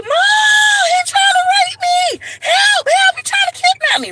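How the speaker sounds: very high, loud, frantic screaming; panicked scream for mom. Retold or imitated. Screaming to mom while running from a stranger, frantic shriek. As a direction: shriek for help at the top of your lungs. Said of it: "I start screaming and running".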